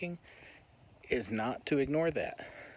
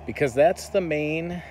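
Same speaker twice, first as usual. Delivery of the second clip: ordinary talk, nothing marked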